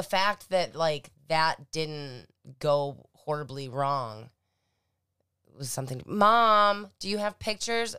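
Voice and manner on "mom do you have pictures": loud, high, drawn-out "Mom"; calling out. Real, not acted. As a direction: holler for Mom across a distance. Calls mom to ask about pictures.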